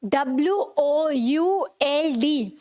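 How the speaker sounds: each letter of "W O U L D" named slowly; spelling out letters. Shown as a demonstration. Teacher spelling the word for students, letter by letter. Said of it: "वुड में हम बोलते हैं".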